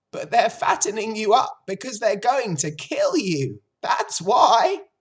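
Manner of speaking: put-on high, loud, knowing old sheep. A narrator's reading. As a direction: press the pig as the old sheep.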